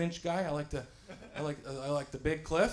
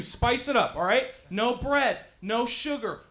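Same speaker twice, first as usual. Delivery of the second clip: normal talking voice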